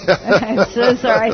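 sorry through a laugh; laughing apology. Real, not acted. Wife laughing at herself, apologising to the Lord.

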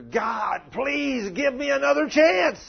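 high desperate scream for another chance; screaming plea. Retold or imitated. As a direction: cry out to God, begging from far below. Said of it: "screaming down there".

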